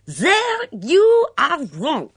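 high, loud and slow, Mitzi crowing over him; triumphant scream. Acted. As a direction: shriek it out in triumph, drawn out. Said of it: "screamed Mitzi triumphantly".

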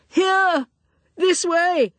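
high call from far off; distant call. A narrator's reading. Searcher calling out from beyond the hotel grounds, high distant call. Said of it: "she heard a faint call".

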